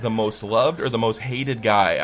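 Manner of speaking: ordinary talk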